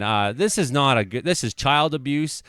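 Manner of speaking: usual speaking voice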